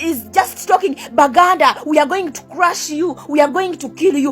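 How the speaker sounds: usual speaking voice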